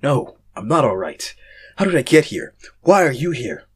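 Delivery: loud, rising panic; freaking out. Acted. Lost narrator panicking at strangers, freaking out loudly. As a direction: shout it as the fear takes over. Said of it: "I started freaking out".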